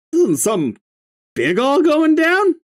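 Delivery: stoner line slipping too high; line read high before correcting; high slip. Shown as a demonstration. Stoner line read too high, wrong pitch. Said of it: "I heard something big was all going down".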